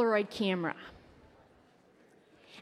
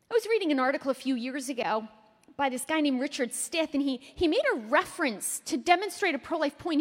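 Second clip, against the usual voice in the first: ordinary talk, nothing marked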